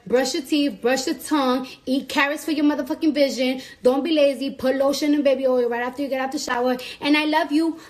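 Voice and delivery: ordinary delivery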